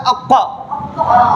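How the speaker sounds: अक्का with a heavy q from the back of the throat and rounded "aw"; thick deep-throat q. Shown as a demonstration. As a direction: fill the mouth on अक्का. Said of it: "इसको पुर करके पढ़ना है".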